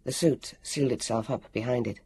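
ordinary delivery

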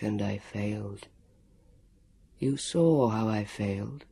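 low, slow, sorrowful guardian; sorrowful ghost voice. A narrator's reading. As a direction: confess the failure mournfully. Ghost confesses failure.

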